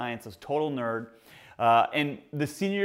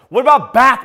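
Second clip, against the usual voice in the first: loud, high incredulous bro; hammed-up outrage at a skipped back day; roommate scolding; 7 semitones higher, 13 dB louder than usual. Roommate scolding his friend's workout: loud disbelief.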